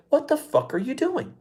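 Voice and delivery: high, incredulous yell at the stage; mock outrage at the contestants; imitating angry heckler. Guy grabbing the mic to yell at the contestants, incredulous. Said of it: "had the balls to get up there and say".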